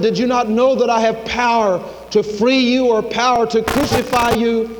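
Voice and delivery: high, forceful ruler's challenge; preacher voicing an indignant governor; acted demand. Pilate asserts power over Jesus.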